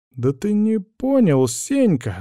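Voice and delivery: high, slow annoyed cry; vexed exclamation. Acted. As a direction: exclaim it in frustration. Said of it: "с досады воскликнул тот".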